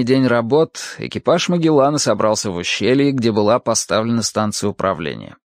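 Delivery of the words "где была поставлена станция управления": usual speaking voice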